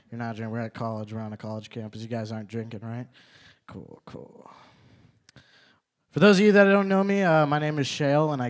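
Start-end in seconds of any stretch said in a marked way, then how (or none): 3.7-4.5 breathy whisper under the breath; whispered aside. Real, not acted. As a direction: mutter it to yourself. Muttered aside after crowd silence.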